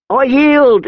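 high, panicked cry of surrender; shrill plea. Acted. Beaten fat boy shrieking to his attacker, shrill and frightened. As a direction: beg shrilly to stop the beating. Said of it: "he shrilled".

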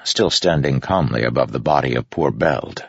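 ordinary delivery, nothing marked

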